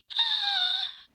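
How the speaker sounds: sharp high gasp; mock shocked gasp. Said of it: "And I'm just like".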